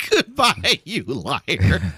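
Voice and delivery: hammed-up echo of a dramatic kiss-off; theatrical mock farewell. Co-host echoes the dramatic farewell.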